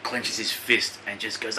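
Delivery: usual speaking voice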